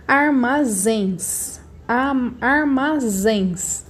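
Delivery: armazéns very slow, in beats, long ZÉNS; stress on last syllable. Shown as a demonstration. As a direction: sound out armazéns, drawing out ZÉNS. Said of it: "a sílaba mais forte é o zens".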